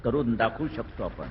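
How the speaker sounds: loud, high-pitched speech to a crowd; rally speech. Real, not acted. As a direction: fire up the crowd from the stage. Politician addressing a crowd.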